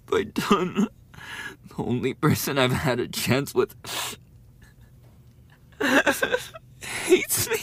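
high, loud, crying wail; sobbing despair. Acted. Rejected bully sobs alone after the victim leaves. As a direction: break down in tears.

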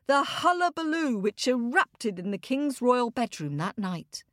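plain reading voice

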